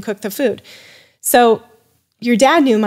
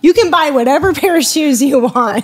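laughing bribe; 6 semitones higher, 5 dB louder than usual. Retold or imitated. Husband bribing her with shoes.

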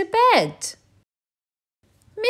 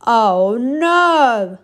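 higher, louder fed-up groan; weary groan; 4 semitones higher, 9 dB louder than usual. Acted. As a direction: groan like a worn-out bear. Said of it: "said Mister Bear".

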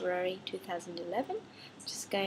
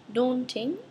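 Daunting said louder with a rising tune; new word presented; 4 semitones higher, 7 dB louder than usual. Shown as a demonstration. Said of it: "new words and expressions".

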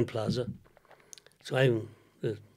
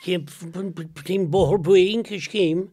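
Cork accent; 3 semitones higher than usual. Shown as a demonstration. [name] repeats his father's villages slowly in a broad Cork accent. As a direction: stretch Boherbue and Kishkeam out in a thick Cork accent. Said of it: "It's a Cork accent".

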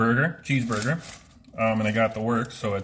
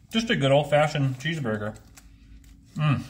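speaking between chews; chewing while talking; 3 semitones higher than usual. Real, not acted. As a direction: mouth partly full of food. Seen 2: bites burger at -2 to -1, jaw working while speaking.

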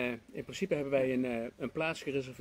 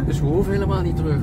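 excited joke; 2 semitones higher, 12 dB louder, faster than usual. Real, not acted. Joking excitedly about staying.

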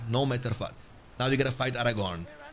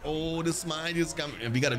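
ordinary delivery

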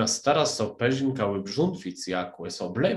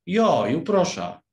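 raised, drawn-out plea; pleading; 8 semitones higher, 7 dB louder, slower than usual. A narrator's reading. Alice begs for a story, pleading. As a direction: beg slowly, with pitch lifted. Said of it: "uiskwiera Alicjo".

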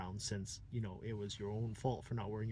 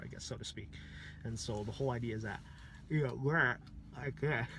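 normal talking voice

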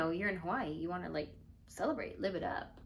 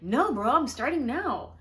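raised, singsong loud refusal; mock insistence; 5 semitones higher, 6 dB louder than usual. Holding firm against a friend's pleading, loud mock insistence. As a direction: dig in, exaggerating the refusal. Retold argument over drinking.

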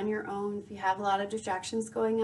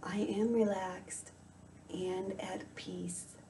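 guided meditation; 9 dB quieter than usual. Acted. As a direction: softly guide the listener into calm, unhurried.

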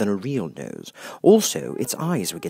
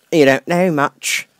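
put-on loud, slow, rude old Duchess; gruff Duchess; 6 semitones higher, 9 dB louder, slower than usual. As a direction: bark it down at the girl like a bossy duchess.

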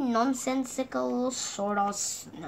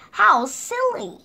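higher and louder, slowly; amused exclamation; 9 semitones higher, 7 dB louder, slower than usual. A narrator's reading. Narrator laughing at the friends' game, higher, louder, slow. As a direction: relish how silly it is.

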